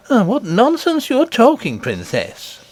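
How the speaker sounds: high, brushing-off nurse voice; dismissive nurse. Acted. Nurse dismissing the princess's story, mildly scoffing. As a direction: wave the child's talk away.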